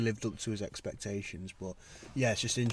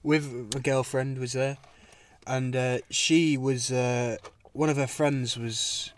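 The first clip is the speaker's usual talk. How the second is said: ordinary talk, nothing marked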